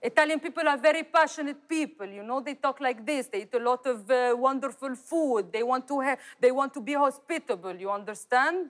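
lightly Italian-accented English, passionate. Shown as a demonstration. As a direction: sell the warmth of an Italian host. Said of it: "الإيطاليان؟". (لهجة إيطالية خفيفة بحماس)

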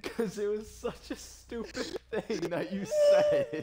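slow, laughing through the words; helpless laughter. Real, not acted. Teasing a friend over a stupid remark, helpless laughter. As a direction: barely get it out for laughing. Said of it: "the most I fucking died of laughter".

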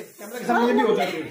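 laughing tease. Pretend. Husband teasing wife on camera.

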